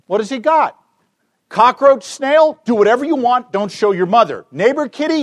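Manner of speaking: a parent reacting fast and loud; excited parent. Parent answers son holding a cockroach or snail, excited loud voice.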